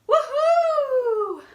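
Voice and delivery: high loud whoop; excited cheer. Real, not acted. New year cheer.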